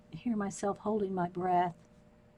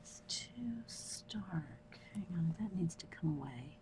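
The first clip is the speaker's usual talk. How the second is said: whisper; 2 semitones lower, 15 dB quieter than usual. Real, not acted.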